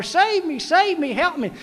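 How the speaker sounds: high, calling out for help in the water; hammed-up cries of a drowning man; playful cries for rescue; mock drowning cry. Clarence thrashing in water, calling.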